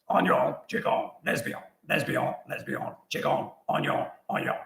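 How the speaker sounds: nonsense words in a put-on Cajun accent; mock Cajun accent. Birthday call to family, answering in mock Cajun accent. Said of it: "Cajun accent, stay in character".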